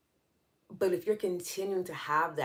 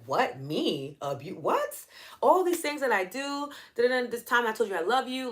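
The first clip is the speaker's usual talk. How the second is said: indignant, rising higher and louder into protest; imitating someone defending themselves, going higher and louder; mock defensive outrage; 8 semitones higher, 4 dB louder than usual. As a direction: play the offended abuser getting louder and shriller in pitch. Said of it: "they start to defend themselves".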